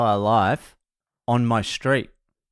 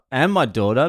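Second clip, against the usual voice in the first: normal talking voice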